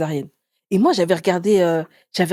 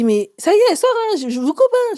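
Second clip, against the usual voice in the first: much higher mock doctor; doctor announcing; 12 semitones higher, 4 dB louder than usual. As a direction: imitate the doctor, voice up high. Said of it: "Il m'a dit". (le médecin annonce la naissance, voix très aiguë)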